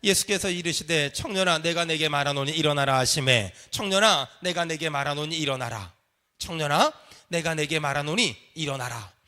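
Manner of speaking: low, solemn recitation; deep-pitched liturgical reading; scripture reading. Acted. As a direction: read it aloud with measured authority.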